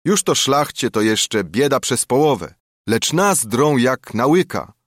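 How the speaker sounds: ordinary delivery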